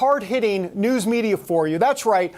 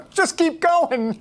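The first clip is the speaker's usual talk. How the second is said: laughing; 8 semitones higher, slower than usual. Real, not acted. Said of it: "Why am I laughing?".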